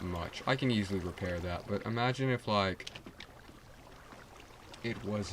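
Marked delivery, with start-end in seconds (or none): none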